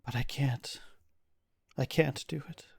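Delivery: despairing, starting in a near whisper; small, grief-stricken voice; crumpled in sorrow. Acted. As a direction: plead helplessly through grief. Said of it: "crumples into just a ball of sorrow".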